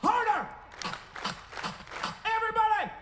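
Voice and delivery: high-pitched, urgent holler; rousing crowd command. Real, not acted. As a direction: drive the crowd to clap along. Rallying crowd to clap harder.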